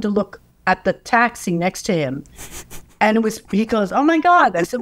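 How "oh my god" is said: sudden high, louder "oh my God"; startled gasp. Retold or imitated. John spotting Paul in the next cab, startled. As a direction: blurt it out in shock.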